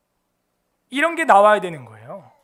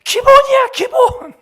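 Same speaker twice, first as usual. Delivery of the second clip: much higher, louder barking coach voice; imitating the strict coach; 11 dB louder, slower than usual. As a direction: bark it like a drill coach at kids. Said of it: "이 감독이 어떻게 하는지 제가 보여줬어요".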